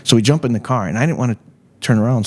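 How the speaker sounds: ordinary talk, nothing marked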